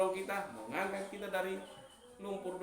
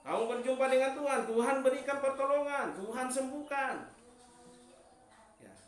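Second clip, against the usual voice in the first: rising, louder sermon voice; preaching; 6 semitones higher, 6 dB louder than usual. Acted. Preacher urging the church to give thanks, loud and rising. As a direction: preach it out to the congregation.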